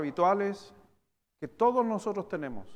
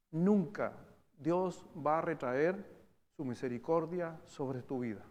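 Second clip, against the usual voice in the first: ordinary talk, nothing marked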